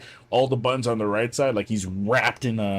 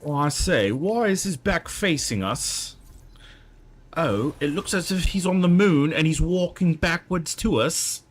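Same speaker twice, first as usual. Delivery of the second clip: put-on British accent; 7 semitones higher than usual. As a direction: play a bewildered British soldier in a British accent. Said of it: "the Brits would not know what the worm is".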